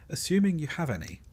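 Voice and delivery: usual speaking voice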